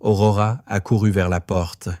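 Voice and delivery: ordinary delivery, nothing marked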